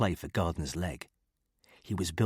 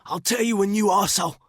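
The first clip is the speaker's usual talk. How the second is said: loud, higher, snarling outburst; angry growl; 14 semitones higher, 8 dB louder, faster than usual. A narrator's reading. Hurt thug snarling at Killen, loud angry growl. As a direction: bark it at him in pain and fury. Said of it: "he growled".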